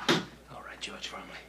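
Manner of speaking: cold, unvoiced threat; menacing whisper. Cop whispers menacingly to suspect.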